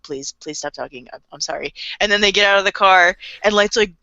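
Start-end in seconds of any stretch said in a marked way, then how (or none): 0.0-1.7 much lower and softer, a sheepish mock beg; meek plea. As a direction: shrink into an embarrassed little plea. Light's dad begging, embarrassed.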